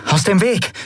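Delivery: high, urgent command; demanding. A narrator's reading. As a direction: demand that they clear the way. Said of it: "forderte Endriel".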